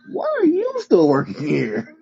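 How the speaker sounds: high, drawn-up mock disbelief; incredulous. Podcaster challenging Brock about his wife, incredulous. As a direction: question him in high-pitched disbelief.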